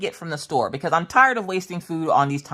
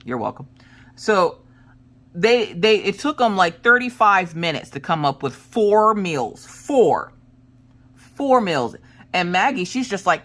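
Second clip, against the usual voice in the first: ordinary talk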